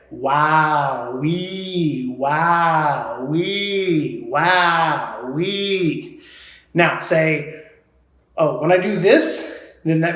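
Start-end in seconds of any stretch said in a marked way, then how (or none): 0.1-6.2 long, gliding "wow, wee" swoops; drawn-out warm-up glides; vocal warm-up. Shown as a demonstration. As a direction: stretch and slide through each word like a voice exercise. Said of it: "let's warm up our voice a little bit".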